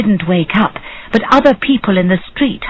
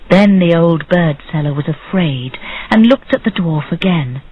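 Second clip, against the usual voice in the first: ordinary delivery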